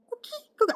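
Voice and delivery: high strained frog chirps; coqui frog call. Shown as a demonstration. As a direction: squeak out two tiny frog calls. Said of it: "my throat is still recovering".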